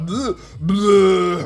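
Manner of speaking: loud gagging "bleh"; mock retching. Seeing the blood come out, mock retching. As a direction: imitate heaving in disgust.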